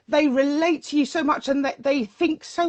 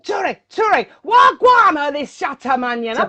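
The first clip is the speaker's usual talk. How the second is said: excited Jamaican patois shout, higher; Jamaican patois accent; 3 semitones higher, 4 dB louder than usual. Retold or imitated. Imitating Jamaican friends praising [name] in patois. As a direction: hype it up like a Jamaican selector. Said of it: "patois accents".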